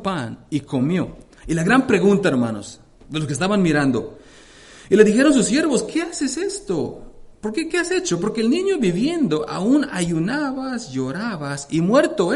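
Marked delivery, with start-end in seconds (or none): 1.5-5.8 loud, raised preaching voice, driving each phrase; preaching at full force. Acted. Preacher to congregation telling of David, at full force. 5.8-10.9 a hint of pleading servants, much higher and slow; mild voiced servants. Servants to King David, lightly voiced pleading question.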